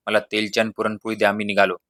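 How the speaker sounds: ordinary delivery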